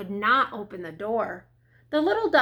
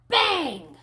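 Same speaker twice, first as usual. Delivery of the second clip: higher, sudden exclaimed bang; door-slam bang; 4 semitones higher than usual. A narrator's reading. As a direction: punch the word like a slamming door. Narrator imitates the slammed door.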